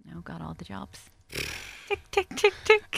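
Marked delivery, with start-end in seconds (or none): 1.9-3.0 playful tick. Pretend. Ticking off jobs.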